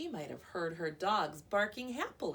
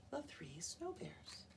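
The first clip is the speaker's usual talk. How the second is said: ordinary delivery, nothing marked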